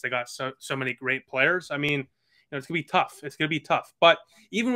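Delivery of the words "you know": ordinary talk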